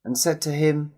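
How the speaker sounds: plain reading voice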